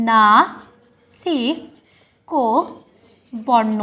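very slow, low dictation with long gaps; slow syllable-by-syllable. Shown as a demonstration. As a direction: spell out নাসিক্য বর্ণ syllable by syllable. Teacher dictates the term slowly.

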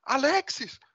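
high, startled cry of a name; shocked recognition. Acted. Man seeing his dead brother's ghost, high and startled.

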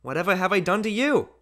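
put-on high puzzled little boy; bewildered child. A narrator's reading. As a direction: ask like a baffled child. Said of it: "blinking his eyes in bewilderment".

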